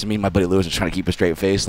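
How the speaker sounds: ordinary delivery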